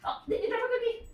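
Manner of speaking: fast, high-pitched sing-song to a dog; high puppy talk. Real, not acted. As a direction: coo at the puppy, quick and high. Talking to puppy.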